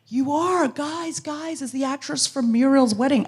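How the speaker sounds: high, insistent tipsy fan; drunk woman. Retold or imitated. Drunk woman rallying the party, excitedly insistent. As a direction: call friends over in a high drunken voice. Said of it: "She was very drunk".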